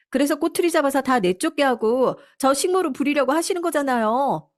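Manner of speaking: high, aggrieved accusation; defiant daughter-in-law. Acted. Daughter-in-law accusing mother-in-law of wanting a maid, high defiant whine.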